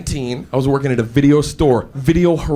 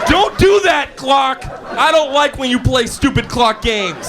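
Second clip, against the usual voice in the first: high-pitched whiny telling-off; mock scolding; 7 semitones higher, slower than usual. Late sleeper tells the clock off for games, whiny mock scolding. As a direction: scold petulantly, high and slowing down.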